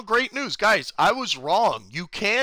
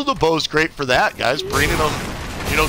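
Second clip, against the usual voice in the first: ordinary talk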